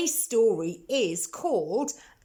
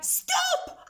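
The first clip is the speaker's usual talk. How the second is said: very high cry of stop; shrill-high old lady cry; cried stop. Acted. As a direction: cry out to make the pig stop. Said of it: "The little old lady cried".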